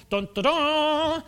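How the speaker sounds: loud, slow heroic "dun, dun, dun"; mock fanfare. Preacher mocking immortal eighteen-year-old, triumphant fanfare. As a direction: trumpet out a superhero flourish, high and drawn out.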